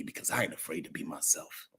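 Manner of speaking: soft unvoiced whisper. Real, not acted. Whispered aside.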